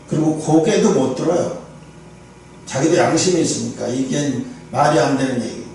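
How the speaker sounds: usual speaking voice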